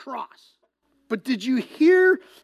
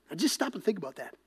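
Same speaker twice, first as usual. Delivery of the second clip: ordinary delivery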